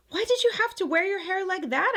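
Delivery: put-on high, curious little girl voice; small child asking. Granddaughter asks her grandmother about her hair, childlike. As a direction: ask it like a wondering child.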